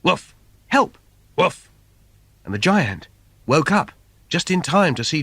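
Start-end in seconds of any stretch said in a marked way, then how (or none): 0.0-1.7 dog barking for help. Acted. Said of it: "the bark called out".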